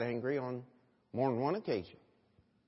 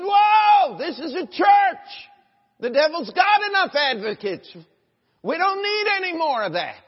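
loud, high-pitched preacher's outburst; retold shouted rebuke; 13 semitones higher, 12 dB louder than usual. Preacher retells rebuking the devil's advocate in church, shouted protest. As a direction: shout it as an indignant objection.

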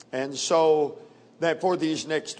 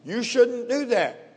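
higher, drawn-out gentle caution; mild advice; 7 semitones higher than usual. Shown as a demonstration. Preacher contrasting advice with Jesus' command, mild caution. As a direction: advise against it in a high, slow lilt. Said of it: "Quite a difference between the two".

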